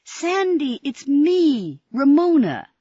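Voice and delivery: put-on high, loud girl's call; urgent call. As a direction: make him hear you, urgently. Ramona calls insistently to Sandy.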